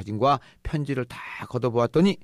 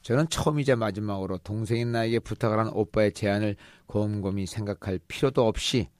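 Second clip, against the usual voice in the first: ordinary talk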